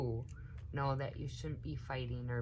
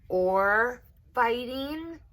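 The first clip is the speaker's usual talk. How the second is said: slow, louder, higher probing ask; suspicious question; 9 semitones higher, 11 dB louder, much slower than usual. A narrator's reading. Cousin questioning the children, suspicious. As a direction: drag it out like a suspicious grown-up.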